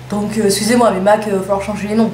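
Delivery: usual speaking voice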